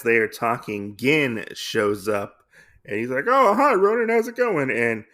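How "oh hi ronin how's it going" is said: high, loud mock-casual hello; breezy greeting. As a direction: toss out a hammed-up cheery hello. Acting Gin's cheery greeting.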